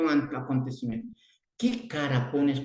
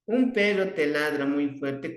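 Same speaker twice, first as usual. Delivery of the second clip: normal talking voice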